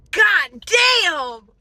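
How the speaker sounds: loud mock outburst from the drive-thru guy; retold exclamation. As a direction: belt it out like the cashier did. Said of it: "shutting the window and goes".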